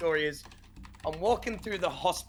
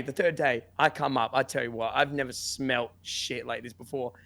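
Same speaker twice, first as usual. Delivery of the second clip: usual speaking voice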